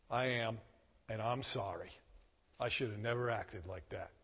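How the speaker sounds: almost hush it, caught and sorry; soft, contrite apology with the head bowed; humbled apology. Retold or imitated. Humbled confession to the manager.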